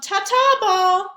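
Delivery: high, loud teasing goodbye; smug farewell. Acted. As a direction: wave him off, gloating. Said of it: "he called".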